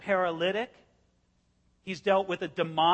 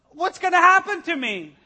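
high, loud, worried voice; anxious man; 9 semitones higher, 9 dB louder than usual. Retold or imitated. The paralyzed man on the bed, panicked and high. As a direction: pitch it up like a panicked patient. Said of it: "He's like".

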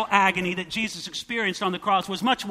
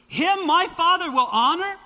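high and louder, questioning rise on Father; stunned rhetorical question; incredulous echo; 9 semitones higher, 5 dB louder than usual. Shown as a demonstration. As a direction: repeat it as if you can't believe it. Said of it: "Are you kidding me?".

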